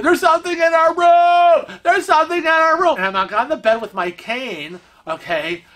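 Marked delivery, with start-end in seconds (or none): 0.0-3.0 high, loud panicked yell; retold scream. Retelling waking husband, screaming in panic. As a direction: shriek it like something's loose in the room. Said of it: "And I'm screaming".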